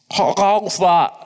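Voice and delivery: slow muffled question. Acted. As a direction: ask as if mouth is covered. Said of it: "a muffled voice asked from down below".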